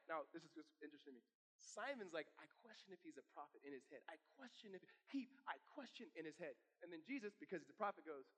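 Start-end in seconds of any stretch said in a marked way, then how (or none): 2.4-6.1 hushed, dropped way down, like thinking to himself; a quiet inner voice questioning; inner thought voice. Retold or imitated. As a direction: murmur it as a private doubt. Simon's inner thoughts, "in his head.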